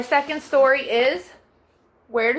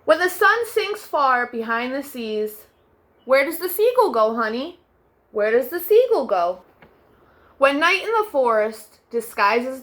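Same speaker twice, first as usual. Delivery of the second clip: put-on gentle storybook narration for children; bedtime story reading. A narrator's reading. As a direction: read it like tucking kids in at night. Reads second picture book aloud.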